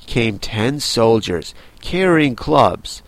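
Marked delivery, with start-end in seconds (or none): none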